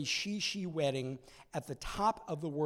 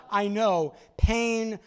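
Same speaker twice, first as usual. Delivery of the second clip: ordinary delivery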